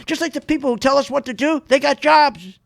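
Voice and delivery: normal talking voice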